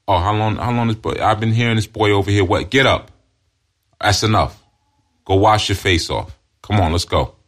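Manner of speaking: acting out a father ordering his child up; stern father. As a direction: cut the crying short like a no-nonsense dad. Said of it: "See, that's what a father does".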